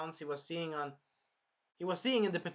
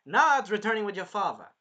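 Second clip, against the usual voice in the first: louder, higher acted refusal; forceful retold refusal, hitting it hard; emphatic refusal; 4 semitones higher, 9 dB louder than usual. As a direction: put your foot down, imitating a hero. Acts out Naofumi's firm refusal.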